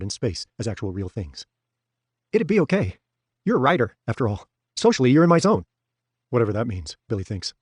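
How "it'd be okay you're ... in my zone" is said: lightly voiced pushy salesman, a bit higher; chummy, a touch too eager; eager Hoff. A narrator's reading. Narrator voicing Hoff leaning in on Billy, lightly raised and chummy.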